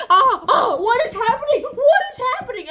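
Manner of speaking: hammed-up alarm; mock panic. Incas panicking at the eclipse, mock fearful cries. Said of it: "everyone's like".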